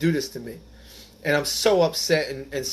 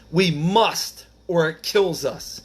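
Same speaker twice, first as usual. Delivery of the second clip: slow, raised closing words; solemn reading; 4 semitones higher, 5 dB louder, much slower than usual. Acted. Closing the reading to the meeting, slow and solemn. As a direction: deliver it slowly as a solemn promise.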